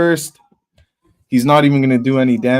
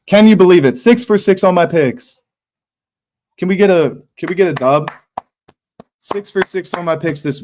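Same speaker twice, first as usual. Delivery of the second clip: higher, louder gloating cheer; excited celebration; 7 semitones higher, 5 dB louder than usual. Real, not acted. Streamer boasting to chat about perfect picks, excited. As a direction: celebrate your winning picks.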